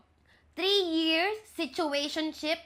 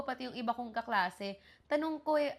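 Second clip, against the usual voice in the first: ordinary delivery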